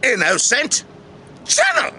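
slow, drawn-out welcome with sudden high notes; theatrical host intro. Acted. Host welcomes viewers to the channel, theatrical intro. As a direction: stretch out a grand show opening.

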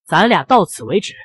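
ordinary delivery

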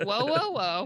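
mock Staten Island accent on whoa, whoa, whoa. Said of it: "There's Staten Island accent".

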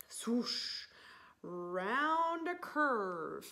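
breathy swoosh, slow; whooshing sled sound. Acted. Reading to kids, voicing the sled's swoosh. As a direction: draw out the swoosh round the bend.

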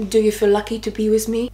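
mock French-accented boyfriend; bad French accent. Arrogant date asks if she feels lucky, bad French accent. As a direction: imitate him in a rough French accent. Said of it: "doing a really bad French accent".

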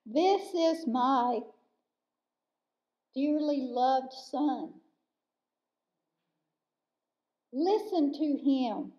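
slow, solemn voice from a cloud; imitated echoing voice. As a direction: draw the words out slowly with long pauses, as if they echo. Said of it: "I could just hear it like it was echoing".

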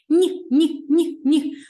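high, loud teasing no no no; playful no-no. Pretend. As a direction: wag a finger at the wrong answer. Playful rejection. (грайливе «ні, ні, ні»)

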